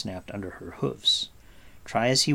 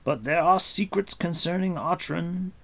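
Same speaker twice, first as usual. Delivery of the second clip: higher, weighty warning; Gwydion; 6 semitones higher, 4 dB louder than usual. Acted. Gwydion warns of Spiral Castle.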